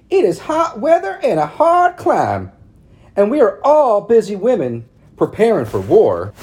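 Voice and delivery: high, loud prissy society matron; prim town lady. A narrator's reading. Narrator voicing Missus Cady calling on Mama, high and prim. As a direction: lift it up into a fussy church lady. Ladies voiced in read story.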